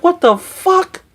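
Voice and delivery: high, drawn-out recalled astonishment; slow, high-pitched mock-stunned reaction; stunned disbelief. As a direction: stretch it out high, as if reliving the shock. Retelling own stunned reaction to giants.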